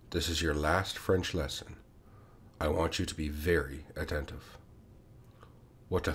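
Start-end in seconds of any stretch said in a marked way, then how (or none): 0.1-4.6 softer, solemn "This is your last French lesson", calm and falling; grave and gentle. A narrator's reading. Teacher announces the last French lesson to the class, soft and grave. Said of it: "in the same grave and gentle tone".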